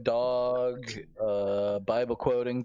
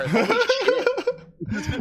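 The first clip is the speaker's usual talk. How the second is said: laughing; 7 dB louder, much slower than usual. Real, not acted. Laughs at the lightning death story.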